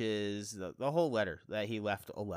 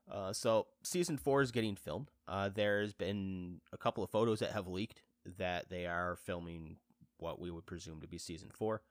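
ordinary talk

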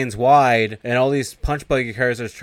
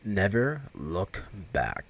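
Satan setting his condition, slow and menacing; ominous warning; 2 semitones lower, 8 dB quieter, much slower than usual. Retold or imitated. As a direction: intone it like a curse. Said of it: "Satan's like".

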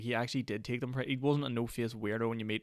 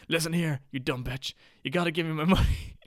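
imitating a scammer yelling, higher and louder; mock angry shout; 6 semitones higher, 7 dB louder than usual. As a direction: bark the demand, higher and louder. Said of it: "He goes".

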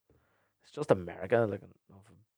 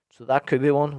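normal talking voice

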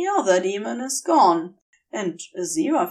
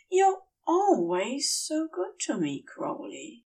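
higher, soft, fading into a sigh; tender Aziraphale; 6 semitones higher, 4 dB quieter than usual. Acted. Aziraphale thanks Crowley, soft and adoring. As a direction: say it gratefully, melting.

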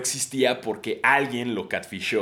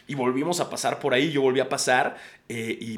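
ordinary talk

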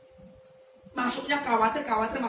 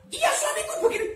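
much higher, whiny wife complaining; mock complaining wife. A wife complaining to the pastor, much higher. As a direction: play the caller grumbling. Voicing the caller.

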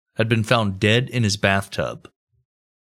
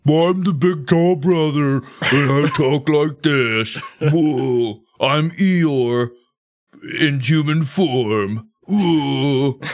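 slow, higher, mopey donkey drawl; Eeyore-like tall brother; 4 semitones higher, much slower than usual. Retold or imitated. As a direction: drag every word out like a gloomy Eeyore. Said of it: "That's how the brother sounded".

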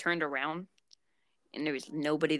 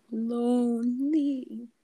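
very slow, stretched word; drawn-out mock sad; 6 semitones higher, much slower than usual. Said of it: "drawn out mock lonely".

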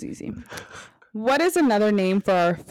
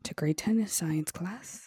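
whispering; 10 dB quieter, much slower than usual. Acted. Teasing herself to remember.

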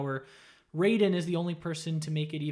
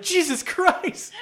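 much higher, louder, slow shocked cry; 14 semitones higher, 8 dB louder, much slower than usual. Retold or imitated. Katana sees her clone, a hammed-up shocked cry. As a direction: recoil in horror and stretch it out. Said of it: "And Katana's like".

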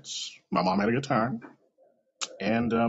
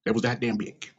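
usual speaking voice

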